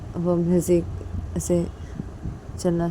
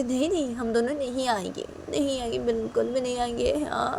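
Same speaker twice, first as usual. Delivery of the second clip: higher, soft playful no; teasing refusal; 6 semitones higher, 3 dB quieter than usual. Acted. Father refuses to come to wife, teasing. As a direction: refuse sing-song.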